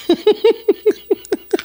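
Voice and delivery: high giggling burst of laughter; helpless high-pitched laughter; real laugh. Real, not acted. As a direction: crack up in a high string of giggles. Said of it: "I'm laughing because she's a joke".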